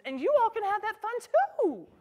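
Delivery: bright sing-song lift to the viewers; a high, happy call to join in; cheery invitation. Real, not acted. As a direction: sell the fun to them.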